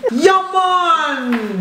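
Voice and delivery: put-on Jamaican accent. Mum showing off her accent to her son, hammed-up Jamaican. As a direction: stretch it out in a mock Jamaican accent. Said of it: "Jamaican, you go first".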